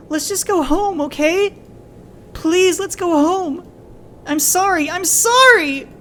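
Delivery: very high, loud, slow pleading; sobbing plea. Acted. Child pleading with father to go home, high and tearful. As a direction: beg him to come home.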